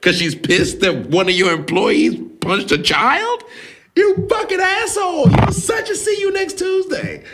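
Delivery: building from incredulous to a high shrieking yell; rising outrage, ending in a raw high scream; furious lady. Retold or imitated. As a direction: play the fired teacher losing it at the principal. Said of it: "I'm this lady".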